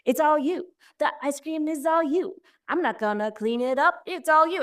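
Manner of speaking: high singsong taunt, playful and bouncy; singsong teasing. Retold or imitated. Mom teasing her preteen about the ice cream, singsong. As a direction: tease it out like a dancing mom. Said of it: "She was just like".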